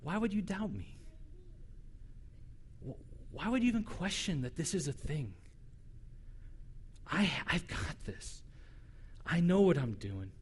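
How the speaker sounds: gentle, not harsh. Retold or imitated. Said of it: "not in such harsh tone".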